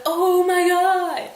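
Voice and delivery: high loud slow oh my god; hammed-up shock. Laertes hearing his dad is dead, hammed-up wail. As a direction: wail it in exaggerated horror.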